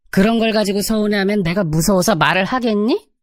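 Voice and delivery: lightly voiced mother-in-law, scolding; a hint of a sharp-tongued mother-in-law; nagging mother-in-law. Mother-in-law scolding her daughter-in-law, mildly nagging voice.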